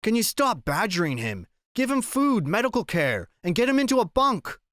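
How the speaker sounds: high, forceful commanding voice; exasperated captain taking charge; barked order. A narrator's reading. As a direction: snap orders at the crew. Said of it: "one man barked".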